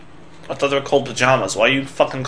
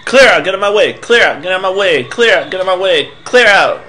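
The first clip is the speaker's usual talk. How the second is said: high, loud, chanted command; pushy, repeated order; 6 semitones higher, 5 dB louder than usual. Acted. Game character ordering the player out of the way, pushy.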